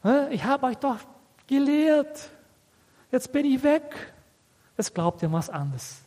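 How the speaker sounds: high-pitched, exasperated lament; acted frustration. Preacher lamenting to those he taught, acted frustration. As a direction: wail it lightly, as if at a loss. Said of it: "der versteht so ein Gefühl".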